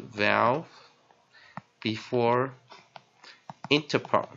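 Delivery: "vowel, before, interpon" with long pauses; word by word. Shown as a demonstration. Teacher dictating a spelling rule, slow and spaced. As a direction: separate each word of the formula deliberately.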